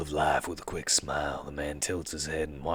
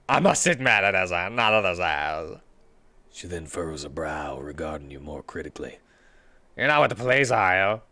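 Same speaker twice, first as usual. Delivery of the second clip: suspicious, guarded questions; Jessica voice; 2 semitones higher, 8 dB louder than usual. Acted.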